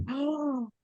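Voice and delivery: high, slow, drawn-out "oh"; retold breath-catching shock; awestruck gasp. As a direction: act out a gasp of amazement. Re-enacts shock at seeing herself.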